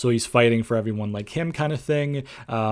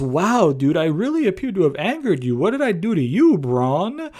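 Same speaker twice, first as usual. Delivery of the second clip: swooping, higher-pitched mock innocence; sing-song feigned surprise; imitated Shane McMahon; 5 semitones higher than usual. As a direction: act lightly baffled by his anger, pitch rising and falling. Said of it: "Shane interrupts. He says".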